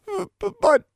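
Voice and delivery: high, frightened stutter; stammering. A narrator's reading. Cornered Tomo tries to explain, stammering. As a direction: trip over the word in a high, scared voice. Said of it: "Tomo stammered".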